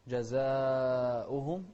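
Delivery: جزاؤهم chanted slowly with a long "zaa"; drawn-out chanted جزاؤهم; four-count madd. Shown as a demonstration. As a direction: stretch the a of جزاؤهم before the hamza. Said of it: "أربع حركات".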